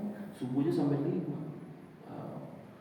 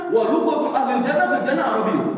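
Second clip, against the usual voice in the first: much higher and louder, proclaiming the hadith; emphatic preaching; 10 semitones higher, 9 dB louder than usual. Real, not acted. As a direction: proclaim it to the whole room. Quoting the Prophet emphatically. (menyerukan hadis dengan lantang)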